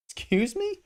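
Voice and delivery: high outraged excuse me; shocked, offended stranger; indignant surprise. Acted. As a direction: squeak it in offence. Offended passerby.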